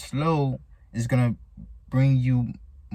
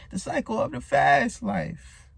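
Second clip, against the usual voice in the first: higher pitched, stressing fast; raised emphasis; 8 semitones higher than usual. Shown as a demonstration. Repeating his lesson line to viewers, higher.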